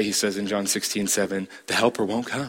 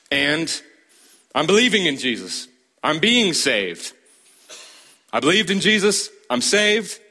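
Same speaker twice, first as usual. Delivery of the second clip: emphatic, higher declaring voice, stress on being; voicing Paul; 6 semitones higher than usual. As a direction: proclaim it like Paul, lifting each claim. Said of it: "That's how he talks".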